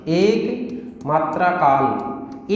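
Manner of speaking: dictation pace. Shown as a demonstration. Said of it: "परिभाषा लिखता हूं मैं".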